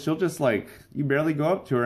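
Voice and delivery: normal talking voice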